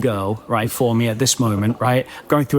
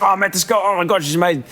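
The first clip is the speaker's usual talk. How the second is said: higher, gushing mock excitement; excited; 7 semitones higher, much faster than usual. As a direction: gush about the new girl. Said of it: "I'm really excited".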